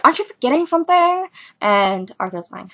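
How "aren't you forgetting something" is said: put-on higher, coy girl voice; girly persona. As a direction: tease it up high and coy. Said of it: "his girl persona's like".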